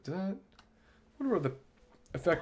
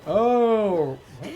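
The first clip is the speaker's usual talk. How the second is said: surprised yell; 8 semitones higher, 12 dB louder than usual. Real, not acted.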